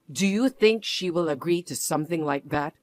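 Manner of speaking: ordinary talk, nothing marked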